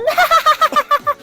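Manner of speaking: high villain laugh; evil cackle. Acted. Villain gloating over the poison, evil cackle. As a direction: cackle in triumph.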